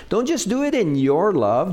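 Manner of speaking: normal talking voice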